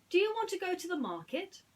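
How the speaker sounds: high, cheerful invitation; Aladdin, eager. Acted. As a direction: brighten up and offer the trip. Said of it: "asked Aladdin".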